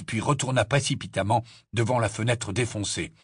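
usual speaking voice

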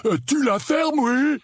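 very high, irritated yell; angry outburst. Acted. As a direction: burst out to silence the teasing. Dudley silences teasing cousin. (éclat de colère, très aigu)

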